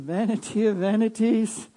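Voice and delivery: loud, emphatic pulpit preaching; fervent preaching. Acted. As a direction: preach it out with fire. Preaching at full force.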